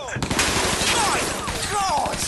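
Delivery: high alarmed cry; alarm shout. Acted. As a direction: cry out as the bullets fly. Machine gun fire at them.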